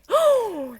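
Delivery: high sharp gasp of delight. Acted. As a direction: gasp as if struck by joy.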